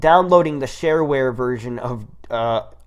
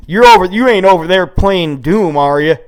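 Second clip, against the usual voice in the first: loud suspicious scolding, a bit higher; parents scolding; 5 semitones higher, 9 dB louder than usual. Retold or imitated. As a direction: accuse the kid loudly. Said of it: "And my parents are like".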